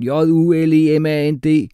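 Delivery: each letter of "J U L E M A N D" spoken separately, loud; spelled out. A narrator's reading. Teacher Jeppe spells "J U L E M A N D" for Umfufu. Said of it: "stavede Jeppe for ham".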